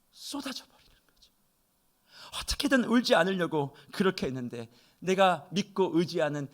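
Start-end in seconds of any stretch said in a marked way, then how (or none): 0.1-1.3 whisper. Real, not acted. Describing tears bursting out.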